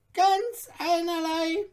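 lively little dwarf. A narrator's reading. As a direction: chatter on like an excitable dwarf. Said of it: "fiel das Zwerglein ein".

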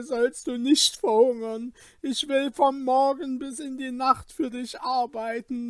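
high-pitched, urgent, determined plea; Kaspar, resolute pleading. Acted. Kaspar offers to work for his friend, resolute plea. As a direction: promise firmly, begging as you go. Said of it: "antwortete Kaspar entschlossen".

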